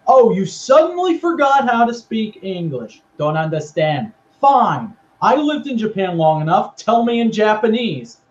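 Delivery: hammed-up irritated demand; mock angry outburst. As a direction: pitch up in sarcastic exasperation. Acted quarrel between two wrestlers.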